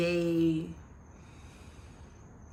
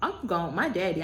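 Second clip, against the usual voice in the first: ordinary talk, nothing marked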